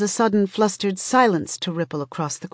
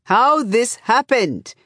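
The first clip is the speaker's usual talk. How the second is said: slow, higher-pitched shouted demand; shouting; 6 semitones higher, 3 dB louder, slower than usual. A narrator's reading. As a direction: shout it out, drawing the words out. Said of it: "Ika is shouting".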